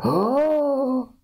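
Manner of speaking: high, drawn-out gasping "oh"; hammed-up high gasp; mock shocked gasp. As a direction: imitate a crowd gasping in shock. Imitates the shocked onlookers.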